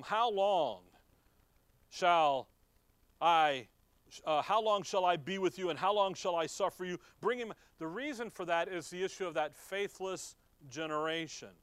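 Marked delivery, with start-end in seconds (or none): 0.0-7.5 a weary master pleading loudly; frustrated rebuke. Retold or imitated. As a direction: hammer out How long shall I with mounting frustration. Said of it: "The reason he's frustrated with them".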